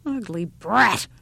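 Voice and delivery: put-on slow, grudging old-woman grumble; muttered insult. A narrator's reading. Old woman insults the child at her table, muttered. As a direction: grumble it with a punch on BRAT. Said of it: "muttered the old woman".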